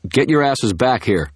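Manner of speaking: high, loud yelled order; Dresden yelling at the retreating agents. A narrator's reading. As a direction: shout them to a stop.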